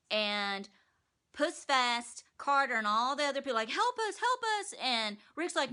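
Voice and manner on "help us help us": high whiny plea for help; mock pleading. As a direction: squeal it like a helpless coward. Townspeople begging Rick for help.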